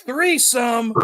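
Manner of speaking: high, drawn-out mock holler; playful high-pitched call; retold yell. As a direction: imitate a prankster's gleeful announcement. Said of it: "and he yells".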